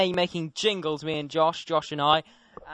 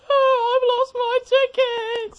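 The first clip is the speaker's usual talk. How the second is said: mock lost-ticket wail; 6 dB louder than usual. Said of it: "and he just goes".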